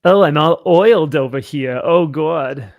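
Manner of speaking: put-on loud Yiddish-accented swooning.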